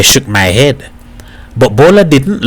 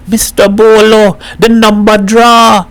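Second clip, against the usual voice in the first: excited; 9 semitones higher than usual. A narrator's reading. Boy runs in with news.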